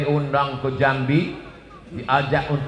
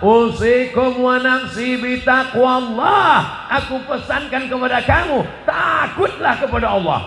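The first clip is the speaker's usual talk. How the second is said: khutbah recitation; 10 semitones higher, 5 dB louder than usual. Shown as a demonstration. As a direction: intone it like a preacher on the pulpit. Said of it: "khotib naik ke atas menyampaikan khutbah". (suara khatib berkhutbah)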